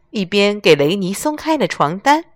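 ordinary delivery, nothing marked

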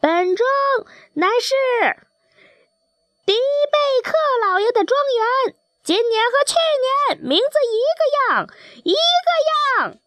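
very high, shrill-pitched rooster cry; crowing rooster. A narrator's reading. Rooster announcing his manor so even the sun hears, high crowing call. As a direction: crow it out proudly, stretching the last words. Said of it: "也能听到他的喊声".

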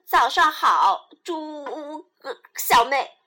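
hiccups chop the words; hiccuping. Acted. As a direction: speak through hiccups. Zebra hiccups while greeting.

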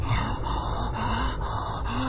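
ragged, breathless gasps; choked gasps for air; panting. Retold or imitated. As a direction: pant hard as if being smothered. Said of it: "はぁ、ってやってるうちに".

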